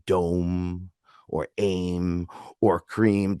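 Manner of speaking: slow, sustained seed syllables; drawn-out mantras. Shown as a demonstration. Teacher giving example seed words, slowly drawn out. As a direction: draw out each mantra word. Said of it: "maybe like a seed word".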